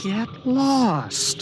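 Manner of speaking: high, sharp get lost; irritated dismissal. Acted. Student tells the invisible alien to leave, irritated and high. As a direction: snap at it to go away.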